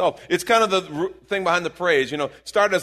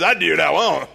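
loud, playful catchphrase shout; mock defiance; 4 semitones higher, 8 dB louder than usual. Quoting people around River City, loud mock defiance. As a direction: holler it like a running joke. Joking quote of townspeople repeating catchphrase.

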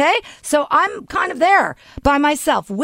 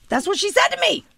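ordinary talk

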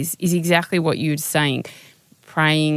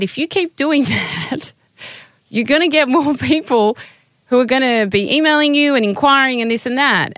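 higher, louder mentor's voice, lively; imitating her mentor; 8 semitones higher, 4 dB louder than usual. Mentor warning her about more inquiries, higher and louder. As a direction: lift your voice up to quote him.

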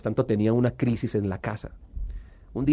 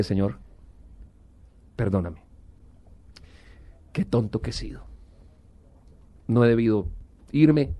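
humble prayer; 3 semitones lower than usual. Retold or imitated. Retold prayer kneeling in church.

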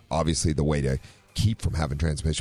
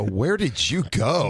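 mock question; 6 semitones higher, much slower than usual. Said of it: "I was gonna go".

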